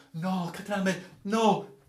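high, drawn-out denial; urgent, long-held refusal; insistent exclamation. Acted. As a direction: plead it insistently, high and slow. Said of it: "esclamò egli".

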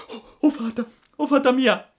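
very high, loud panicked wail; shrieking. A narrator's reading. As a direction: shriek for help like a terrified puppet. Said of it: "cominciò allora a strillare il burattino". (strillo disperato e acutissimo)

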